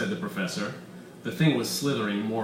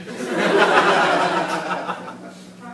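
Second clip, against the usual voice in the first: laughing; 8 semitones higher, 6 dB louder than usual. Acted. Comic beat.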